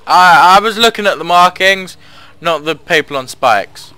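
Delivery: loud, shaken horror; startled horror. Real, not acted. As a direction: recoil at the gruesome sight. Sees people on spikes.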